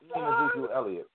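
very high mocking squeal; high teasing voice. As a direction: squeak it out to tease the caller. Host repeats tease even higher.

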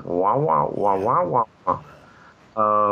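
wordless muffled-trombone wah-wah of a cartoon grown-up; Charlie Brown adult babble. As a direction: imitate an adult droning in meaningless wahs. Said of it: "Charlie Brown listening to an adult".

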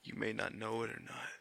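ordinary delivery

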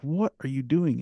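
a touch of shocked disbelief, lifted in pitch; mild retold alarm. Teenage boy confronting the woman who swallowed the note, mildly alarmed. Retelling his shocked reaction to her.

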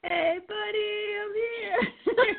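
frog voice. Real, not acted. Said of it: "I sound like a frog".

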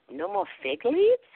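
high, rising disbelief on "leaves"; incredulous question. Retold or imitated. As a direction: echo it back as a baffled question. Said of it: "Like, really? What is that?".

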